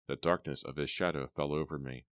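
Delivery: ordinary delivery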